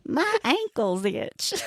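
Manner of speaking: put-on high, slow Southern accent. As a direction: mimic a Southern drawl. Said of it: "You said that in a Southern accent and everything".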